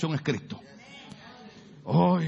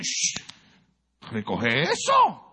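higher, slow mock parental order; parent scolding; 7 semitones higher, much slower than usual. Pastor imitating parents telling youth to tidy up, higher. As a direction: tell the kid to pick it up. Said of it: "el papá y la mamá le dicen".

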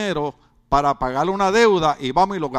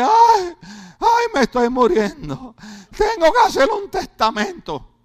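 whiny dying man; 7 semitones higher, 4 dB louder than usual. Retold or imitated. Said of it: "y dice".

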